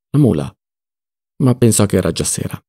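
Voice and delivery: ordinary delivery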